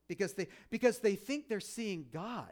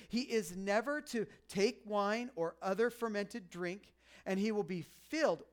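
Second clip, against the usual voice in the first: scripture reading; 4 semitones higher than usual. Acted. Reads scripture aloud.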